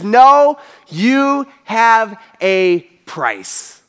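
sharp loud no, then very slow and deliberate; slow, grave reply. Retold or imitated. Teacher answering his boasting disciple face to face, slow and grave. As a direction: lay each word down slowly. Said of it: "looks at him in his face and says".

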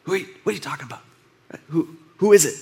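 hushed, rushed whisper; a quick secret whisper to Jesus. Retold or imitated. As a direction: lean in and whisper it fast. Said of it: "and he whispers".

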